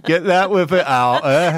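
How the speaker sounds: slow, high, hammed-up mock Yorkshire; mock Yorkshire accent. Host teasing the Yorkshire guest, in a mock Yorkshire accent. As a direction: tease her with a broad put-on Yorkshire.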